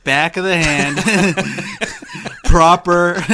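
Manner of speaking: loud, laughing through the words; laughing threat. Real, not acted. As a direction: threaten him while cracking up.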